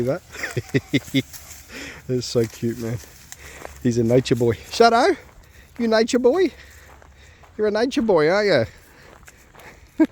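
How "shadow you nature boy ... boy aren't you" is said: much higher, sing-song pet voice. Real, not acted. Owner cooing at his dog Shadow in baby talk.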